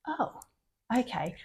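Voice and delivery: small quiet "oh, okay"; quiet deflated reply. Retold or imitated. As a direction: say it softly, a little crushed. Meek reply to a teacher's word.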